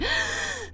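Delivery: big, audible huffed sigh; exaggerated breath. Showing how they breathed when annoyed, exaggerated sigh. Acting out the angry deep breath.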